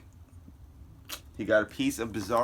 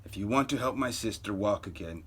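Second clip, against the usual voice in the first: plain reading voice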